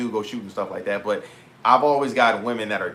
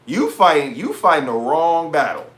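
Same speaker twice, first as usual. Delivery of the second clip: emphatic repeat; 4 semitones higher, 6 dB louder than usual. Real, not acted. Repeating the retort, amused.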